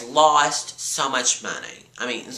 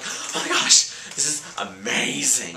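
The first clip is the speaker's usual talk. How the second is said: whispered mock delight. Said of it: "I was like".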